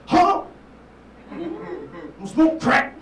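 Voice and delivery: high, loud, slow crackhead yell; mock yell. Gas station dude shouting back at the comic, hammed-up yell. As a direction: bellow it back like a wild man.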